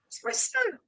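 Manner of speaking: very high, soft, gushing; a proud mum beaming at her big lad. Retold or imitated. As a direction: swoon with pride over your son. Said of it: "I'm like a proud mum".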